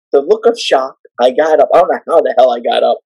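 normal talking voice

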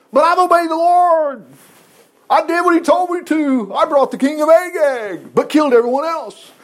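high-pitched, hammed-up self-defence; indignant protest. Saul insisting to Samuel he obeyed, mock indignant protest.

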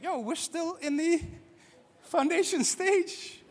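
baffled onlooker, drawn out; mock disbelief. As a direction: stretch it out like you can't believe it. Mocking someone checking an unfinished building.

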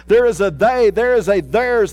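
"they" and "theirs" punched; stress on they and theirs. Shown as a demonstration. Pastor drives home "they" and "theirs" to church. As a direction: hit "they" and "theirs" hard. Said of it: "what I'm emphasizing".

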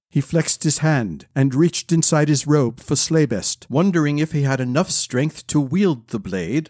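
ordinary delivery

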